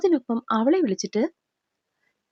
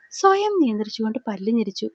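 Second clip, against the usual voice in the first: ordinary delivery, nothing marked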